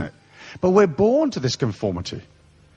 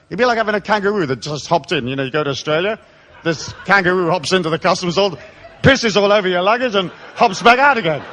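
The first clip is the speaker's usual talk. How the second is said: normal talking voice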